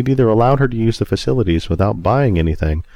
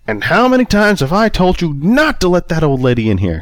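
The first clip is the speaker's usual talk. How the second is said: high, loud, furious scolding; angry boss shouting at his worker; screaming manager; 10 semitones higher, 3 dB louder than usual. A narrator's reading. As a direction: rip into the employee at the top of your voice. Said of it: "he screamed".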